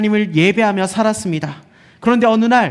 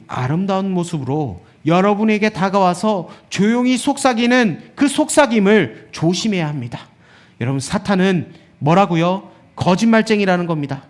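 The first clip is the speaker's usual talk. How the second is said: ordinary delivery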